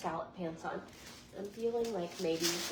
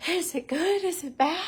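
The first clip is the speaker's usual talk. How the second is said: higher, louder rising asks; eager questions; 6 semitones higher, 9 dB louder than usual. Real, not acted. Asking viewers to judge the outfit, loud eager questions.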